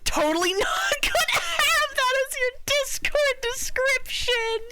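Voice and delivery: very high squeal through laughter; helpless laughing. Real, not acted. Streamer teasing a friend about a Discord description, laughing squeal. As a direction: squeal it slowly while cracking up.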